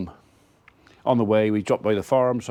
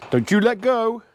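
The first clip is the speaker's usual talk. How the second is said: put-on high, anxious plea from an old father; dad in a wheelchair begging his son not to let go, high and pleading; imitating Dad; 9 semitones higher than usual. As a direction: squeak it like a nervous dad. Dad again on the steep downhill.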